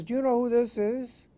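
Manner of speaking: high, loud, imperious British officer; mock-outraged British bigwig on the line; very British accent. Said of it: "said this in a very British accent".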